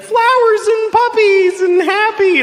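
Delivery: high, slow sing-song dreamy voice; dreamy wife. Retold or imitated. Wife lost in blissful thoughts, high dreamy sing-song. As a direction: float it up like pure bliss. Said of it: "she's like".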